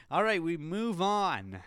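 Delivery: MMA Guru. Retold or imitated. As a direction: imitate a host's slow, punched-up transition line. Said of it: "as M M A Guru would say".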